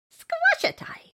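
high, loud pained squeal; small creature voice. Acted. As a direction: cry out in pain, very high. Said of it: "said Elephant".

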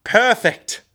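high squeaky character shout; Mister Jones shouting. Acted. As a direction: pop it out high and excited. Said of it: "you hear Mister Jones shout".

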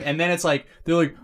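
usual speaking voice

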